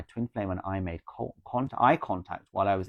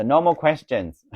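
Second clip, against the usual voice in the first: firm no more questions; 6 semitones higher, 9 dB louder, slower than usual. Real, not acted. Repeats her ban on questions.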